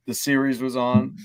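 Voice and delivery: normal talking voice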